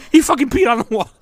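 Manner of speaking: put-on high, loud, panicky girlfriend voice; a hammed-up hysterical wife; imitating hysterical Trisha. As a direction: mimic her frantic outrage. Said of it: "She was like".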